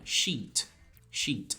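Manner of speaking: "Sheet" repeated with a long ee; "Sheet" as if spelled with several i's. Shown as a demonstration. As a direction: softly model "Sheet" with a long i. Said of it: "o som dela é como se fosse vários Is".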